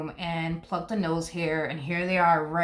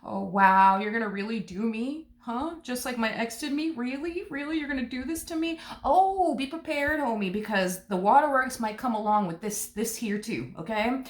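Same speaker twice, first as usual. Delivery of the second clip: higher, whiny mock outrage; higher mock hurt, teasing the crybaby; 5 semitones higher than usual. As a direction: ham up a wounded drama queen. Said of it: "They're gonna go as far as to say".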